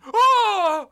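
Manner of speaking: shrill high "Ah!" of outrage; high shriek. A narrator's reading. Michi shrieks at Charles in outrage. Character cries out, high far.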